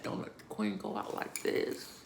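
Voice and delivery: breathless whispered prayer; soft desperate whisper; whispered plea. Retold or imitated. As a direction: beg the Lord in a hushed whisper. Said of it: "I said".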